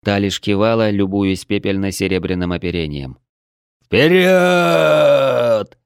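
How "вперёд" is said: loud, high, drawn-out rallying shout; growled battle shout. A narrator's reading. Droy roars his troops into battle, loud drawn-out shout. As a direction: roar the troops forward, stretching the word. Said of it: "прорычал Дрой".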